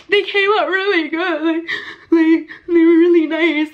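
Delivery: hammed-up crying while trying to answer; imitated weepy reply. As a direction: re-enact talking through happy tears. Retells tearful answer to actor.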